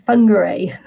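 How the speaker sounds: "Whangarei" opening with an f, ending in ay; Maori pronunciation. Shown as a demonstration. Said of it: "the Maori pronunciation is".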